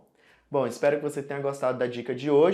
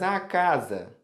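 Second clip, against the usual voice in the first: sloppy mock casa; 4 semitones higher, much slower than usual. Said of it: "você faz".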